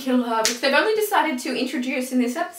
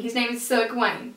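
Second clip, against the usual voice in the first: usual speaking voice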